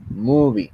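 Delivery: MOO-vie, first syllable of Movie punched; stress on first syllable. Shown as a demonstration. Teacher showing the tonic syllable, Movie stressed at the start. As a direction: lean hard on the start of Movie. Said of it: "la sílaba tónica está en un principio".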